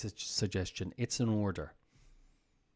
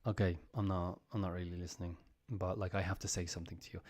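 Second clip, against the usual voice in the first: soft, half-hearted brush-off; mock dismissive mumble; 5 semitones lower, 8 dB quieter, faster than usual. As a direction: mumble it without listening. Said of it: "of like".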